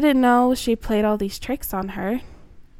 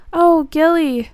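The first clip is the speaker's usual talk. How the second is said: higher, louder and slow with relief; overjoyed sister at last; joyful cry; 6 semitones higher, 6 dB louder than usual. Acted. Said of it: "Brooke was so happy".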